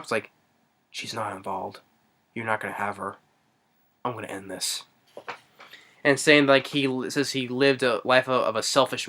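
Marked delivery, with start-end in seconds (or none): 0.9-4.8 low, very soft acted resolve; hushed, low imitation of a determined man; quiet defiance. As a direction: refuse under the breath, low. Retelling man defying the room.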